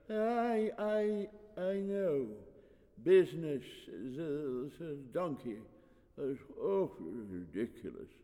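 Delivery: wheezy, fragile priest. A narrator's reading. Ancient priest muddled by the business, fragile wheeze. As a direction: wheeze it out frailly. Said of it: "wheezed the high priest in a fragile voice".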